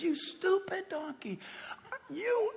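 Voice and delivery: strained high mock rage at the donkey; hammed-up angry rider scolding his beast; angry Balaam. Balaam scolding the donkey he is beating, hammed-up anger.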